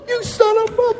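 very high, strained mock outrage; outraged father. Father running out at his daughter's ex, high mock outrage. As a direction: squeeze the curse out high.

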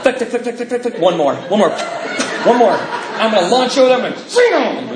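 high, laughing, loud clicking countdown; gleeful plotting. Retold or imitated. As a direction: wind up to launch a zinger. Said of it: "And I'm just like going".

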